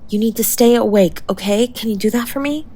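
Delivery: usual speaking voice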